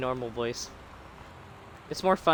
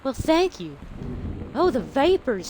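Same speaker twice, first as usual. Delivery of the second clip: put-on Southern Belle accent; 3 semitones higher than usual. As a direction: ham up a fainting Southern Belle. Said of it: "the really bad Southern Belle".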